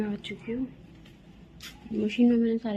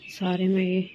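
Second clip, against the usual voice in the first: ordinary talk, nothing marked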